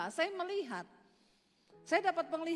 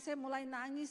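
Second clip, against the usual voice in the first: usual speaking voice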